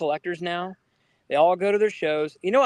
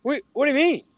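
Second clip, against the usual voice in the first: confused uncle; 8 semitones higher, faster than usual. Retold or imitated. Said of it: "And he went".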